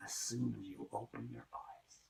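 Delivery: hushed, low whisper; sly whisper. Acted. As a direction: whisper the trick slyly. Sly aside.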